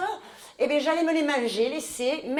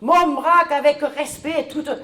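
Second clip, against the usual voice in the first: mon bras lancé plus haut et plus fort; déclame au bras comme une tragédienne; jeu tragique; 4 semitones higher, 7 dB louder than usual. Pretend. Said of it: "que je joue le rôle". (déclamation pompeuse)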